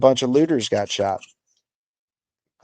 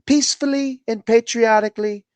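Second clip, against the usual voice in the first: lightly mocking impression, much higher; mocking Trump quote; 11 semitones higher than usual. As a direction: echo his words in a high, sneering put-on voice. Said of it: "just because he said".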